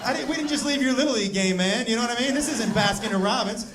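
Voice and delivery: loud, fast mock outrage at his son; hammed-up scolding of a freeloading kid; mock angry rant. Dad rants at son.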